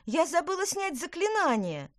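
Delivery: high, loud dismayed cry; alarmed exclamation. A narrator's reading. Witch remembers forgotten spell, alarmed exclamation. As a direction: blurt out the sudden realisation. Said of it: "воскликнула Петронелла".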